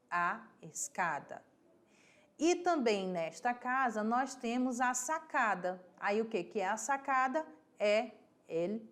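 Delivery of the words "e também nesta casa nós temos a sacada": usual speaking voice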